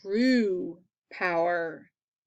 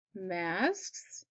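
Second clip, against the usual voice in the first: a much softer, puzzled "Masks?"; confused Vader; 2 semitones lower, 6 dB quieter than usual. Acted. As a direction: Vader quietly baffled by the word. Vader realizes they're kids.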